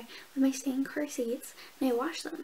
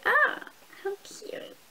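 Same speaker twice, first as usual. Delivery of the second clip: high, slow, sing-song coo; put-on cute voice at the skyline; cutesy gush; 8 semitones higher, much slower than usual. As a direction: coo over it like a delighted child. Retold delight at city.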